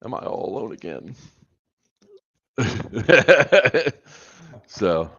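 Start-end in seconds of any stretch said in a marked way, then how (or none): 2.6-3.9 loud laugh. Real, not acted. Laughs alone on stream.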